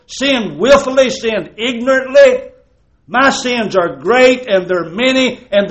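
rising fervour on each sinned; impassioned sermon litany; building preaching litany. Acted. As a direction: preach it, climbing line by line.

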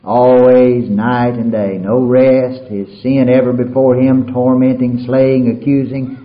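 "Always" hit loud and slow; full-force preaching. Acted. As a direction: hammer "Always night and day" slowly and loudly. Verse repeated, preached loud.

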